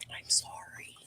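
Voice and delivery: breathy hushed whisper, barely voiced; soft unvoiced apology while slipping away. Real, not acted. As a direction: whisper it so nobody wakes.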